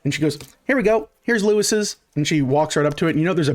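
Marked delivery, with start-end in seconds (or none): 0.7-2.0 put-on high, chirpy little girl voice; little girl figure. Little girl leading the party to the shop, high voice. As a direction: pitch it well up like a child announcing a stop.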